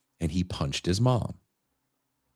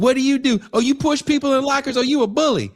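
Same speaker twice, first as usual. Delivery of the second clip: very high, loud mocking inmate voice; prisoner taunt; 5 dB louder, faster than usual. Prisoners taunt the small kid as a bully, shrill and loud. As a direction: sneer it up high and fast.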